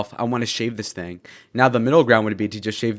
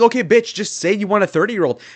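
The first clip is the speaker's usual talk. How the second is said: high, louder mocking comeback; mock retort; 10 semitones higher, 4 dB louder than usual. Him retorting to the college girls, raised mock comeback. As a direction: snap back in a raised mock voice. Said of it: "I'm like".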